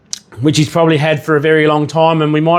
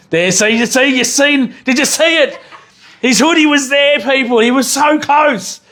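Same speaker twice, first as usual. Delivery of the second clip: excited yelling; 10 semitones higher than usual. Real, not acted. Vlogger yelling at the screen as the dog nearly gets caught, excited. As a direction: blurt it out, can't contain yourself. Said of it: "Sorry if I'm yelling in the mic".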